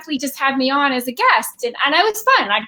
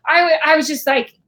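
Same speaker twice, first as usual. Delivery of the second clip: ordinary talk, nothing marked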